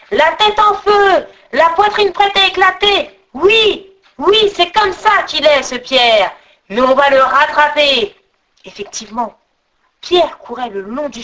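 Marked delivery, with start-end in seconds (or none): 0.1-5.6 high, loud yell of a pursuing horde; high-pitched hue and cry; howling. Acted. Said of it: "se jeta sur ses traces en hurlant". 5.6-8.1 loud cry of pursuing trolls; acted yell of the chase; howling. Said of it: "se jeta sur ses traces en hurlant".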